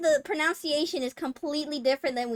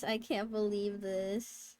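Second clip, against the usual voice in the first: ordinary talk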